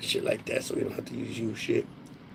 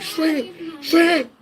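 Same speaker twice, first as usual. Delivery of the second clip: high-pitched nickname call, building louder; imitated calling out; 13 semitones higher, 7 dB louder than usual. Retelling a kid hollering his nickname, high call-out. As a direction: call out a nickname across a room.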